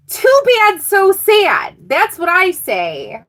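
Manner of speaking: very high, loud playground taunt; mock taunt. Reader mocks Virgo's jealous rival, taunting. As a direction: tease with exaggerated fake pity.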